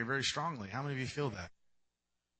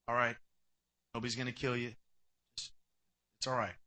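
gentle reassurance; 4 semitones lower, 3 dB quieter than usual. Retold or imitated. God reassuring Cain.

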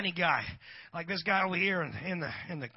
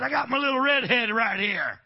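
loud, higher mock bravado; boastful comeback; 5 semitones higher, 11 dB louder than usual. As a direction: crow it back across the room. Retells boastful reply to bikers.

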